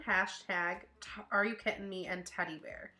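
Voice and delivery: usual speaking voice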